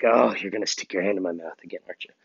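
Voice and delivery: whispered voice of the dog; whispered pet thought; dog's inner voice. Pretend. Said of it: "he's leaning up against me like".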